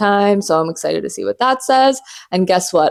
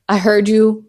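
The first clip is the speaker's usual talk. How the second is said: usual speaking voice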